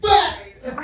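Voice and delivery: high, punchy single-word bursts; shouted swearing. Acted. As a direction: fire off each word as a separate high shout. Imagined award show of shouting.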